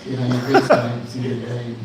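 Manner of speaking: sing-songy turtle. Acted. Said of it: "You can make it all sing-songy".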